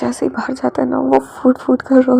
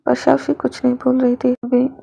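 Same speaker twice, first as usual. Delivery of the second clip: plain reading voice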